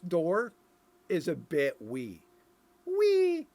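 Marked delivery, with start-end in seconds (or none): 2.9-3.5 very high, loud sung-out squeal; playful squeal. Pretend. Joking about the tiny castle door, a high squeal. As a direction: squeal the word like a delighted child.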